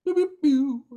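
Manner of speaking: high, loud zap sounds; laser pew noises. Pretend. Plugging the April event with playful blaster noises, high and loud. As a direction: fire off cartoon laser blasts.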